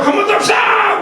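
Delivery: high shouted call with a laugh. Retold or imitated. Song leader urging another chorus, laughing shout. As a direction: egg them on again, laughing. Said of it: "인도하는 사람이 충만하니까".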